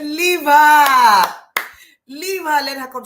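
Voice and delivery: high, loud shout at the crowd holding her back; retold shout. To the people holding the lady back, a high loud shout. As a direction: yell it out, daring her to come on. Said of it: "I shouted at this woman".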